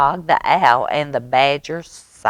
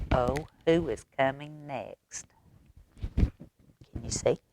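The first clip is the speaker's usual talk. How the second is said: hushed question; 10 dB quieter than usual. Real, not acted. Aside asking children about page.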